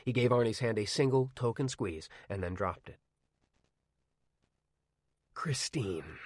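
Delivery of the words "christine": dry voice. A narrator's reading. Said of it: "he said in a dry voice".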